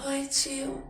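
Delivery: put-on high childlike voice; a small spirit girl greeting sweetly; tiny child greeting her uncle; little girl's voice. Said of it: "falou aquela vozinha".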